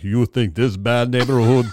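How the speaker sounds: lightly voiced Russian punchline; understated Russian tough guy; mock Russian accent. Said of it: "putting the voice on".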